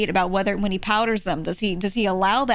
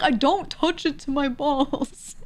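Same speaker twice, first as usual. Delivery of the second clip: slow, higher-pitched imitation of indignation; mock pissed off; 5 semitones higher, slower than usual. Friend denying it to teasing mates, mock pissed off. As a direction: draw out the protest, mildly hammed-up. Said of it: "He would get so pissed".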